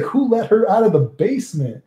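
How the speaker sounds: high-pitched mock outrage; making fun. Friend ribbing him about a girl, mock outrage. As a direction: imitate a friend's incredulous jibe. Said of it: "he was making fun of me".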